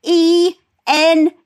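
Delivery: F E N T Y spelled letter by letter, slow and a bit loud; mock careful spelling, slow; spelling it out slowly. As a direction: spell each letter deliberately like an old mum. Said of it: "And she goes".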